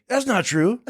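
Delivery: high-pitched indignant denial; mock protest. Manager denying the singer's rumor to the assistant, high mock protest.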